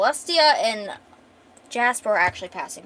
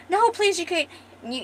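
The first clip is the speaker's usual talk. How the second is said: desperate plea; 8 semitones higher than usual. Retold or imitated. Retold plea to save his wife.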